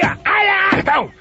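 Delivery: shrieking, high-pitched outburst; the boss shouting his outrageous praise; high delighted shout. Acted. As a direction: crow it out, thrilled and loud. Boss erupts in mock admiration.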